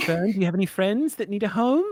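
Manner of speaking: high-pitched cooing question; pet talk. Acted. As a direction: ask as if talking to a small animal. Tara talks to the cute pet.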